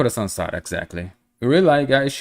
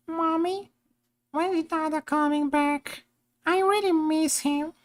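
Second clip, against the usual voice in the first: put-on very high, soft little-kid falsetto; squeaky small child, missing a parent; 4 dB quieter than usual. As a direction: pipe up like a tiny child asking mommy. Voicing little Kiana to mommy.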